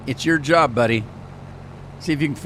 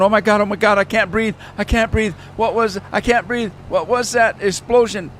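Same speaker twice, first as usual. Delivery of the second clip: high, loud, frantic cries of can't breathe; hammed-up panic, voice way up high; panicked gasping driver; 9 semitones higher, 4 dB louder than usual. The driver panicking after the big bang, high frantic cries. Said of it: "and he's going".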